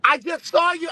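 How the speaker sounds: high-pitched excited fan shout. Retold or imitated. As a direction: squeal it like a starstruck fan. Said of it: "He said".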